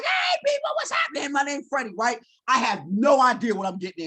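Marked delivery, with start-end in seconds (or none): 0.0-1.9 mock overjoyed new student hollering hello; excited greeting. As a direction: call out to everyone like it's the best day ever. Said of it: "I walk around campus like".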